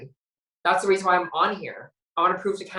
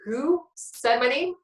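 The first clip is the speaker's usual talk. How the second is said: high, slow, disbelieving question; retold shock; 8 semitones higher, slower than usual. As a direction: re-enact stunned disbelief, pitch jumping up. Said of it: "I was shocked".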